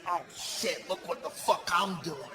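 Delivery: hushed, low voice of a crazy stitcher; soft, low, mock-crazed aside; crazy surgeon. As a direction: mutter it softly like an unhinged doctor. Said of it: "but he was kinda crazy".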